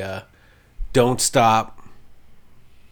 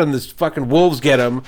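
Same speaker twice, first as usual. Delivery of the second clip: ordinary talk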